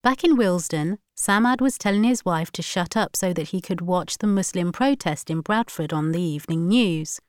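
usual speaking voice

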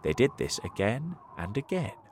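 usual speaking voice

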